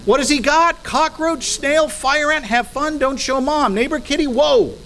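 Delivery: high, eager guessing at the catch; a parent playfully guessing what the boy is holding; mock parental excitement. Parent reacting to child's catch.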